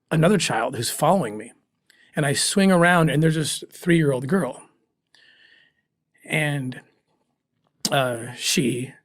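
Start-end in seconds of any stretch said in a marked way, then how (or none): 6.2-8.9 choked up. Real, not acted. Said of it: "I'm getting so emotional".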